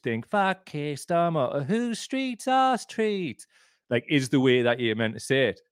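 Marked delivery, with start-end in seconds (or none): 0.3-3.5 high, drawn-out mock southern English chant; southern crowd accent. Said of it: "sound like a southern crowd".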